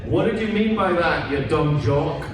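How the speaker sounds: ordinary delivery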